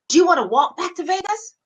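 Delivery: high, quick sharp question; imitated irritated threat. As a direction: mimic a fed-up driver's threat. Imitates friend's threat in car.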